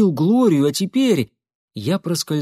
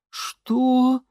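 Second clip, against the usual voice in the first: a high, moaning "what?"; a startled, higher moan; 11 semitones higher than usual. A narrator's reading. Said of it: "простонала Нэнси".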